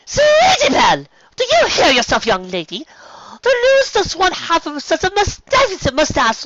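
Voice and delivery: elder sister appalled at the suggestion; scolding big sister. Acted. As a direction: scold the little one in shock.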